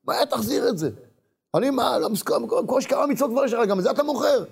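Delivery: high-pitched acted indignation; outraged scolding. Wife scolding husband for selling his afterlife, high and outraged.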